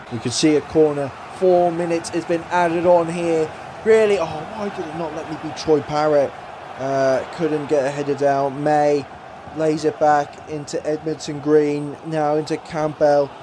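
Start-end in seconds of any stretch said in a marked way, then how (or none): none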